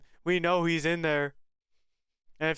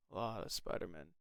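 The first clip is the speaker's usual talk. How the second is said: muttered; 9 semitones lower, 11 dB quieter than usual. Real, not acted. Repeats under breath.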